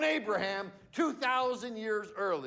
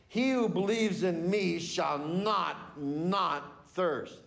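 "not, not thirst" with both "not" hit hard and firmly separated; stress on not not; slower than usual. Shown as a demonstration. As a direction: drive the doubled "not" home like an exclamation point. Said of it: "there needs to be an exclamation point".

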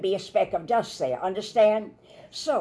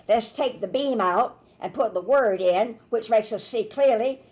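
usual speaking voice

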